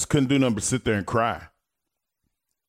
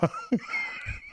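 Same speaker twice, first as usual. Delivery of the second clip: high cackle; 7 dB quieter than usual. Retold or imitated. Friend's laugh on phone.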